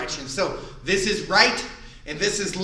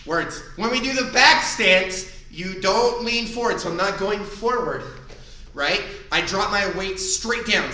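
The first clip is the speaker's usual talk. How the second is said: ordinary talk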